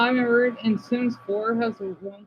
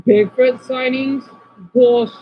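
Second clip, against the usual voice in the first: louder, slowed disbelief that snaps out; scoffing outburst; 4 semitones higher, 8 dB louder than usual. Real, not acted. Streamer mocking a Bigfoot video, loud scoff. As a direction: call it out loudly.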